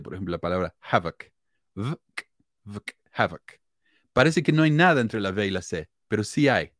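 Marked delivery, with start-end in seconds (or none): 0.8-3.6 "havoc" with the vowel almost swallowed, "vk"; havoc schwa demo. Shown as a demonstration. Host demonstrating the hidden schwa in havoc, vowel nearly swallowed. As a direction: squeeze "vk" together, barely a vowel. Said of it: "cuando yo digo entre dos consonantes".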